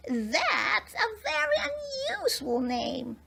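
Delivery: very high, slow, tiny fairy voice; a wondering little fairy, slow and high; thoughtful fairy. A narrator's reading. Fairy muses to Noel about his name, very high and slow. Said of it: "said one of the fairies thoughtfully".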